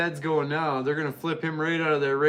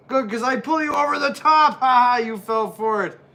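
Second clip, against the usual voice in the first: higher, louder gloating villain voice; 6 semitones higher, 5 dB louder than usual. Acted. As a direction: taunt him like a sneaky heel wrestler. Acting out a tricky wrestler's taunt.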